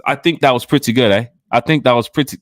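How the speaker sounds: loud attempt at an Australian accent. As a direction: put on a punchy mock-Australian voice. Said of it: "that's not Australia".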